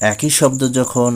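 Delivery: normal talking voice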